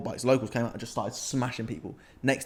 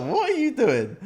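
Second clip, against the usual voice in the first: much higher, in disbelief; retold shocked question; 11 semitones higher, 5 dB louder than usual. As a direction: react to the bloody scene in alarm. Seeing bloodied friend.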